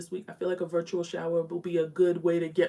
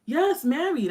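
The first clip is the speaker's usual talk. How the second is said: 1 excited; 10 semitones higher, 5 dB louder, slower than usual. Real, not acted. Reacting to viewer again.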